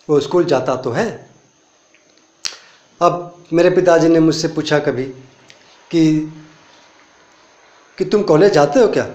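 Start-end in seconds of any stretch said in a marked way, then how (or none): none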